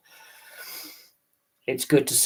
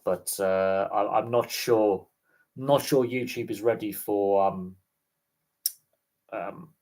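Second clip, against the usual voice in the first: usual speaking voice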